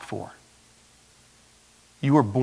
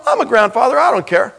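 usual speaking voice

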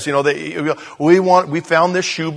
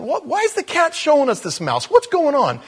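put-on puzzled child; 12 semitones higher, 4 dB louder than usual. Child self retold asking mother.

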